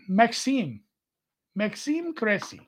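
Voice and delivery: light French accent on the name; touch of unplanned French accent; accidental French accent. Real, not acted. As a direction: slip a hint of French into the name. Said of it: "I don't know what accent I was throwing in there".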